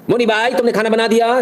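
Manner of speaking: bossy madam. Acted. Madam asks the maid if food is ready, high and bossy. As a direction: play the lady of the house checking up.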